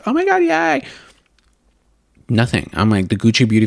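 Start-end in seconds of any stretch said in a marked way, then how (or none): 0.0-0.8 high squeal of mock joy; a high excited yay. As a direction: squeal it like a fangirl. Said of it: "I was so excited".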